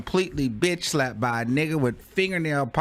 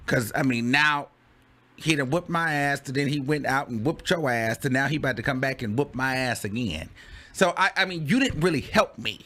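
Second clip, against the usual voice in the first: ordinary talk, nothing marked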